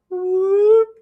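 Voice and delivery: high, loud rising "whoop"; imitated pop-up noise, pitched high; sneaky whoop. Kids peeking from behind the couch, cartoonish whoop.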